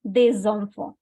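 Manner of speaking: S of des carried into enfants; liaison in des enfants. Shown as a demonstration. Teacher repeats the example, liaison in des enfants. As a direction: des enfants run together on a linking sound. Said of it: "making a liaison between S and E".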